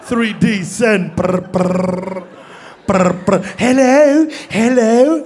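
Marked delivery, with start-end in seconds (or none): 1.1-3.4 trilled "brr" bursts; phone ringing imitation. 3.6-5.2 mock British phone answer, rising; high, puzzled British "hello"; British accent. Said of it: "British, I knew it".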